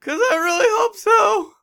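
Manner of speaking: high, pleading whine; insecure poet. Retold or imitated. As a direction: beg desperately, voice cracking. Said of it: "he was like".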